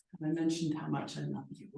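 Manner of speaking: softly delivered mock sweet-talk; sheepish affection. Listing agent thanking the broker after closing, sheepish affection. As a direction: imitate a sheepish, hushed confession of love.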